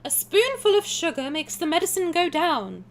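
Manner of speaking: high, bright Mary Poppins imitation; sing-song nanny voice. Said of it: "We love Mary Poppins".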